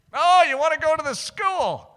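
high, loud, over-bright question; feigned surprise. Retold or imitated. Young man answering the girl's request, feigned surprise. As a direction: act as if only now understanding.

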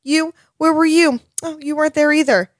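high, scolding child-lord impression; Lyanna Mormont. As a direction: call out the lords like a fierce young lady. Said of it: "And Lyanna Mormont's like".

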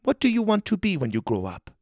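lightly voiced tour host; Chinese woman. A narrator's reading. Woman character voice.

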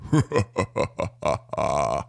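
low, rolling mock belly laugh; deep belly laugh. Laughing at the adamant contractor, deep belly laugh. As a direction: act out a laugh from deep in the stomach. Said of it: "I laugh from the deepest bellows of my stomach".

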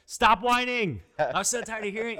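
loud, high exasperated mock scold; imitating wife shouting stop whining; wife snapping. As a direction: play the fed-up wife telling him off. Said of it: "She's like".

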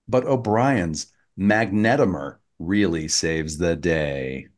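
slow, rhythmic limerick recital; bouncy limerick lilt. Acted. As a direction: recite it like a playful poem. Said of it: "the limerick synopsis goes a little something like this".

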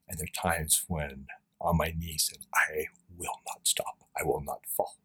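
very soft, withdrawn vow; hushed, near-whispered resolve. Real, not acted. Recalling private struggle to host, hushed vow. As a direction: barely voice it, as if alone on your knees.

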